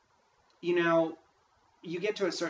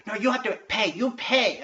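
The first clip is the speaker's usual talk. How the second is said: higher, louder clerk insisting; mock insistent clerk; 7 semitones higher, 6 dB louder than usual. Clerk insisting to the student, mock insistent voice. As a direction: play the stubborn clerk pushing back.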